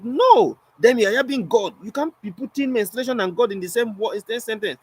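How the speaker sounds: high-pitched, emphatic scolding; imitated furious objection. Offended lady objecting to the poet's wordplay, mock outrage. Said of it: "very furious and pissed".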